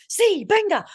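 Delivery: high, loud Spanish cheer; hammed-up Spanish fist pump; mock Spanish shout. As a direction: bark it out in Spanish like a hyped player. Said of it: "you were like".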